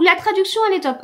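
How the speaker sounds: high, loud rave; mock gushing reviewer. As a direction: play up her own review clichés. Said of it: "vous devez voir des fois".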